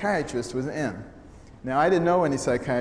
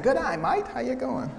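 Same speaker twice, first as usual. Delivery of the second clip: put-on much higher-pitched Australian accent; Australian greeting; 11 semitones higher than usual. Australians greeting a visitor everywhere, imitated Australian accent. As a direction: chirp an imitation Aussie hello. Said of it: "there was someone saying".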